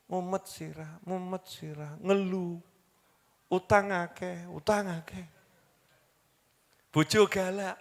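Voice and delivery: a hint of a weary moaner; mock complaining. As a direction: grumble it under your breath like a tired complainer. Example of a complaining congregant.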